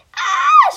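a very high, very loud scream of alarm; shrill scream. A narrator's reading. The kitchen maid screams at the news, very high and loud. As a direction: shriek "Ah!" as the startled maid. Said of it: "schreeuwde de ko-, keukenmeid".

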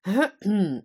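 loud clearing of the throat; king clearing his throat loudly. Acted. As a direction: clear your throat before a royal speech. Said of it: "schraapte zijn keel".

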